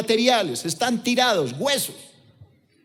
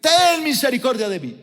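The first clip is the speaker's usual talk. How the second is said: crying out; 5 semitones higher than usual. Retold or imitated. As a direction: keep calling out high, then let the last line drop. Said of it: "sigue gritando".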